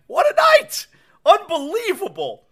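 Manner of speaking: high, thrilled exclamation; overjoyed. Real, not acted. Host reliving last night's win, thrilled. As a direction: let the joy burst out.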